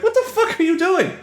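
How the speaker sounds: high, shrieking outrage at an intruder; mock outraged yell. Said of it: "You're just like".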